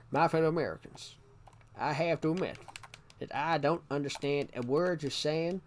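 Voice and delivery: put-on American presidential address; mock Southern-accented president speaking to the nation; George Bush. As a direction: imitate a US president giving a speech. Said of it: "that was a George Bush impression".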